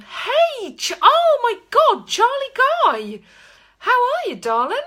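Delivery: high, loud delighted greeting; excited squeal. Real, not acted. Greeting a friend joining live.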